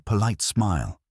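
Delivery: usual speaking voice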